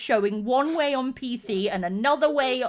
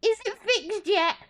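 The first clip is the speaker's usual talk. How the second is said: much higher whiny question; mock nagging user; 10 semitones higher than usual. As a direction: whine it like a pestering kid. Mimicking impatient users.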